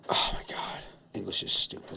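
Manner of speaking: very soft, voiceless exasperation; exasperated undertone. Real, not acted. Host fed up with English spelling, hushed exasperation. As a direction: groan it softly under your breath.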